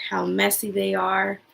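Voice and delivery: usual speaking voice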